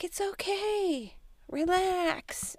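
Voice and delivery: calm her down in a sing-song; soothing husband. Retold or imitated. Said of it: "And he's like".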